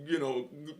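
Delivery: ordinary delivery, nothing marked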